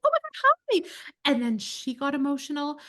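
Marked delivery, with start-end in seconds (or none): 0.1-0.9 high, delighted oh my God; high excited greeting. Retold or imitated. Greeting a nervous fan at a restaurant, high and delighted. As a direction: squeal a happy hello.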